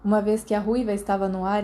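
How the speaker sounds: ordinary delivery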